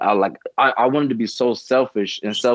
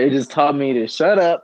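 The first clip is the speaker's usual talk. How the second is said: normal talking voice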